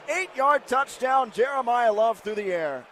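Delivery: high, loud play-by-play shout; excited touchdown call. Real, not acted. Play-by-play announcer shouting a Notre Dame touchdown. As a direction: call the score like the crowd just erupted.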